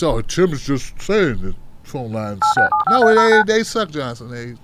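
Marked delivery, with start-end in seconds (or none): 0.0-2.7 low, slow, dismissive mock voice; mocking doubter. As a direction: lower and drag it out, mocking the listeners who doubted. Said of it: "you thought I was playing".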